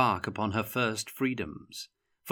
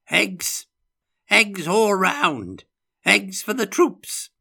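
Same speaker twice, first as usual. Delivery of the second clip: high, loud, drawn-out hollering; triumphant shout; 12 semitones higher, 8 dB louder, slower than usual. A narrator's reading. Old Elector in his dotage orders for his troops, exuberant shouting. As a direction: call out orders to the whole army at full voice. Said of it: "shouted the Elector".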